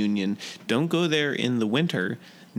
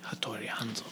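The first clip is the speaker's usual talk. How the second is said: whisper; 11 dB quieter, faster than usual. Pretend. Echoed whisper of name.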